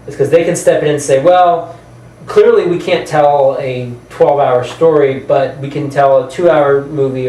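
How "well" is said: normal talking voice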